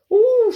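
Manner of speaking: very high, loud squeal of a scream; screamed ooh. Acted. Grandma screams as the second dose takes hold, shrieking. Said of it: "she screamed".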